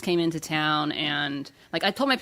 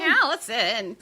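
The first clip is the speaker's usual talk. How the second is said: mock mom voice; 3 semitones higher, much slower than usual. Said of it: "that sounds just like my mom".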